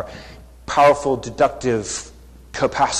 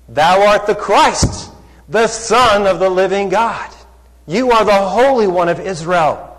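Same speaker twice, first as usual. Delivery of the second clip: higher, louder proclamation; bold confession; 6 semitones higher, 7 dB louder than usual. Retold or imitated. Peter answers Jesus with a higher, louder bold declaration. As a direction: declare it with full conviction.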